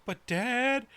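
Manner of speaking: very high, loud pleading little cat voice; begging cat voice. Retold or imitated. Owner voicing the cat begging to sit on the keyboard, very high pleading. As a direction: beg sweetly as the needy cat, very high.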